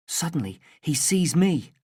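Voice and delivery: plain reading voice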